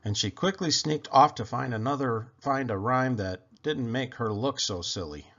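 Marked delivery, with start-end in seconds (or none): none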